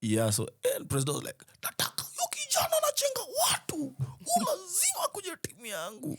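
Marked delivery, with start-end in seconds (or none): 1.6-6.2 high, breathy whispered imitation of the boss; president's voice. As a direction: squeeze it out high and airy like the president. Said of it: "he actually speaks like that".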